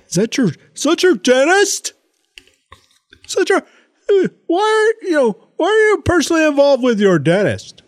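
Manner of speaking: put-on high, puzzled child's voice; kid voice. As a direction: play the confused young son asking. Son's confused question, voiced by parent.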